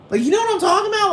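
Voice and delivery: tossed-off falsetto, quick and breathless; squeaky high aside. Acted. Mocking her own rant to the audience, in a quick falsetto squeak. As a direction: shoot way up into a fast squeak.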